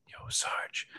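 whispered yo sarge. Retold or imitated. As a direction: whisper it sneakily to the next guy. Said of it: "and I'm just like".

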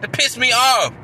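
loud, exasperated anger; real frustrated outburst; angry. Real, not acted. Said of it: "I'm just angry right now".

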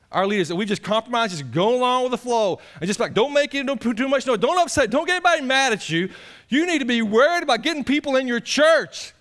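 loud, high indignant preaching; full-force preaching. Acted. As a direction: rail against compromise.

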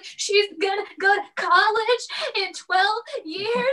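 high, teary wail; mock sobbing wail. Wife sobbing to her husband about her daughter leaving, teary high wail. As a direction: blurt it out like a mom falling apart. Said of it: "I was sobbing on the couch".

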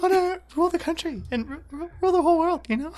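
high, stammering, diffident voice; sheepish mock dictator, tripping over words; Michael Cera. Second friend joining in as the actor playing Hitler, high halting voice. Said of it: "I wanna see Michael Cera play".

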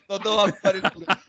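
usual speaking voice

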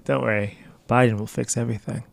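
dry mock reassurance; sarcastic. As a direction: say it deadpan, not meaning it. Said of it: "you're being, like, sarcastic right now".

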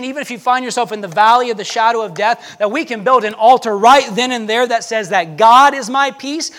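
normal talking voice

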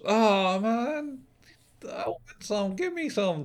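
high-pitched pleading whine; put-on high voice, pleading for a bite; mock begging. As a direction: beg for food in a drawn-out high voice. Acting a begging hot-dog grab.

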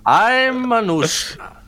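slow, lightly put-on Indian accent. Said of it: "do the Osho accent for him".

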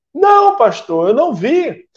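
a church sister pleading her case, pitch climbing; exasperated protest. Acted.

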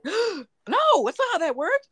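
gasping, high-pitched no; horrified by the wrong fact; shocked. Real, not acted. Gasp at herpes misinformation.

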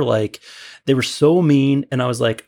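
ordinary talk, nothing marked

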